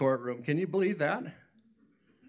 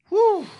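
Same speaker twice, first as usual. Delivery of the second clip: loud high exhale of awe; whew; 12 dB louder than usual. Real, not acted. Preacher to youth, shaken by judges' power, loud whew. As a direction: let out a big whew.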